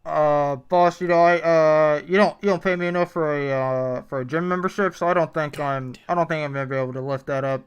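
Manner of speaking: nervous fast food employee talking to boss; drive thru speaker. Acted. Plays employee Michael.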